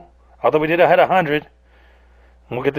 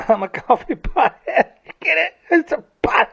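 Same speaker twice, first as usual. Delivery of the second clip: much higher, laughing through the pun; laughing at own joke. Real, not acted. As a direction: giggle out the wordplay.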